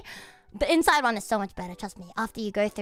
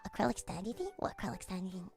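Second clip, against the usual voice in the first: much softer whispered reread; puzzled mutter; 4 semitones lower, 13 dB quieter, faster than usual. Real, not acted. Rereading a chat message, muttering. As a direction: mutter it confused.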